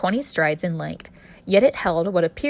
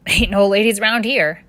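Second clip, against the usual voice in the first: put-on louder, huffy boy's retort; huffed loudly; 3 semitones higher, 7 dB louder than usual. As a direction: huff it out to the newcomer. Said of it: "I huffed loudly".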